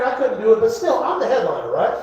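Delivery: usual speaking voice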